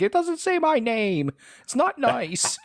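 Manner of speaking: high, slow whiny complaint; mock whiny wife. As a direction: pout it like a sulking wife.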